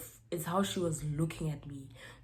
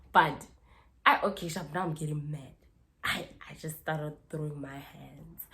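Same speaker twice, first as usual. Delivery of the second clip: ordinary delivery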